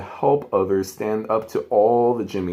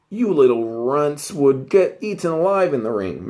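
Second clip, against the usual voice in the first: sneering bully voice, a bit louder; bully taunt; 4 semitones higher, 4 dB louder than usual. Acted. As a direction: taunt them like a schoolyard bully. Bully Jimmy taunting the twins.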